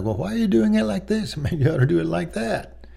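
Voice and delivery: high, pointed, lightly laughing nudge; condescending correction. Retold or imitated. Father correcting his son, high and condescending. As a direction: talk down to him while chuckling. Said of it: "When you say something like".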